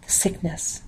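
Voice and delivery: soft unvoiced whisper; barely a breath, afraid to say it; hushed whisper of dread. A narrator's reading. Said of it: "Ted whispered".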